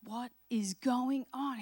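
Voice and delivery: stunned. Retold or imitated. Servant wakes to surrounding army.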